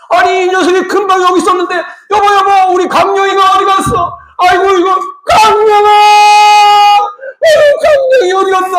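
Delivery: high-pitched acted panic of a searching mother; frantic mother. Mother asking her husband where their child went, in a fuss. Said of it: "우리 어머니는 얼마나 난리 치겠어요".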